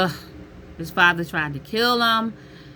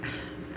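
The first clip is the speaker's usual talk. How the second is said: heavy sigh; 16 dB quieter than usual. Real, not acted. Exhausted sigh.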